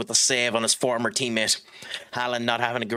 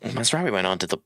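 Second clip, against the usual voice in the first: ordinary talk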